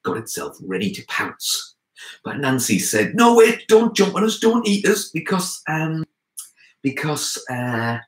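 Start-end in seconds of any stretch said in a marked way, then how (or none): 3.2-5.1 very high, urgent begging; acted panic, pitched right up; panicked pleading. A narrator's reading. Anansi begs the lion not to pounce, high and panicked. 5.1-8.0 high, hesitant searching for an excuse; stalling. A narrator's reading. Anansi hunts for an excuse for the lion, hesitant.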